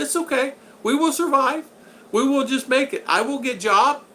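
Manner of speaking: put-on high-pitched, accented-English wife quote; Chicha. Wife reassuring her husband about having no money, high-voiced imitation. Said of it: "She said".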